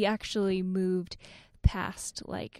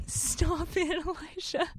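laughing; 9 semitones higher, slower than usual. Real, not acted. Laughing at husband's tease.

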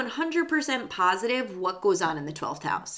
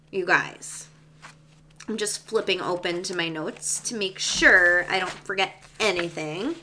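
usual speaking voice

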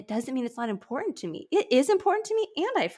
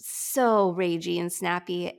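ordinary talk, nothing marked

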